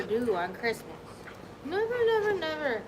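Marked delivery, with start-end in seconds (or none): 1.7-2.8 cooing pet talk. Real, not acted. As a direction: croon to the dog. Cooing at dogs.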